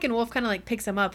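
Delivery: usual speaking voice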